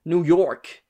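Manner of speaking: badly faked New York accent; amateur stab at a New York accent; attempted New York accent. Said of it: "I can't do an American".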